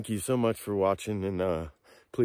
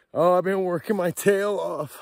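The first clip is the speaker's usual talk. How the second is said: emotional; 7 semitones higher, 5 dB louder than usual. Real, not acted. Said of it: "I'm emotional".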